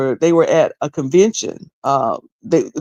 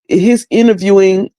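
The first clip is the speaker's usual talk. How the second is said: normal talking voice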